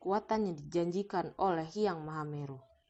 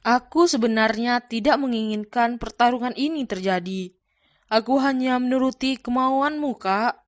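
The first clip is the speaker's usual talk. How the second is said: put-on higher, louder young man's voice; earnest Arya; 4 semitones higher, 10 dB louder than usual. Arya shows his wife the gold, higher and louder. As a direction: lift the pitch and project as the younger brother. Arya reluctant before duel.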